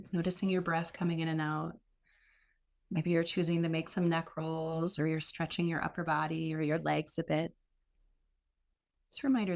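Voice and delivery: a gentle guide settling the class into stillness; guided meditation. Acted. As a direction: ease listeners into the breath, unhurried.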